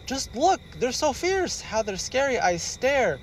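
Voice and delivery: high tense frightened voice; staring at the monsters; scared daddy bear. Acted.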